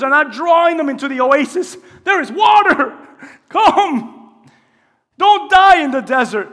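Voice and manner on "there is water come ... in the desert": urgent call. Acted. Preacher voicing God's urgent call.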